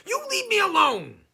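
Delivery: very high, loud frightened squeak; squeaking goblin. Acted. Scared goblin squeaking at Herschel, very high and loud. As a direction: squeak it out in panic. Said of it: "he squeaked".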